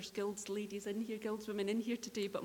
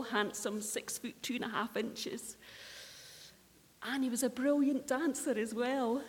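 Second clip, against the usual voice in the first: ordinary talk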